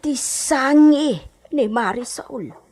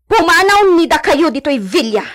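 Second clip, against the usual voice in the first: angry commands; 3 semitones higher, 5 dB louder than usual. Acted. Orders the servants off the hacienda.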